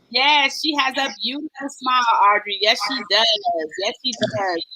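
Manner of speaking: normal talking voice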